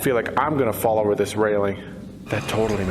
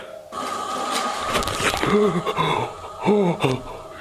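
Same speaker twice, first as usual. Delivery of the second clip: sudden gasp; 5 semitones higher than usual. Real, not acted. Mannequin slides forward.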